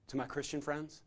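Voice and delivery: ordinary talk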